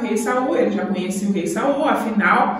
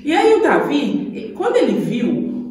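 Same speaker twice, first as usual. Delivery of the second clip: ordinary delivery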